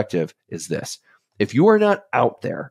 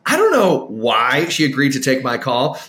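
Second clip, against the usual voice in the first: normal talking voice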